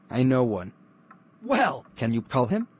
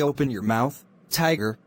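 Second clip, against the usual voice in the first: ordinary talk, nothing marked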